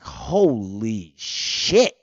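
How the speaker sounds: slow, drawn-out amazement; stunned awe. Pretend. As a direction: let it sink in, very slowly. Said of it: "you gonna be like".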